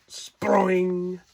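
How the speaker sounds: a sudden high, loud "spring" like a trap snapping; spring sound effect. Acted. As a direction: pop the word out as the net snaps. Trap snaps shut in story.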